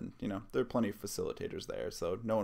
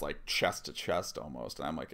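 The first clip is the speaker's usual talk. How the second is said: plain reading voice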